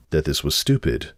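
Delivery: ordinary delivery, nothing marked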